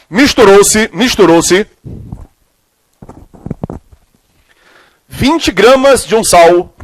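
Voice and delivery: slow, measured dictation with long pauses; classroom dictation. Real, not acted. Teacher dictating a chemistry question to students, slow dictation. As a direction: dictate phrase by phrase, stretching the words.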